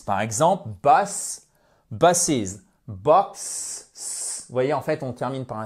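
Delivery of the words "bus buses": BUS, then BUSES with an extra -iz syllable; singular then plural. Shown as a demonstration. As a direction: show BUSES ending in a clear iz. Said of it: "ça devient un E S".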